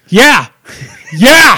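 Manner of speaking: high, loud shouts with laughter; loud exclamation. Real, not acted. Laughing, loud sarcastic agreement.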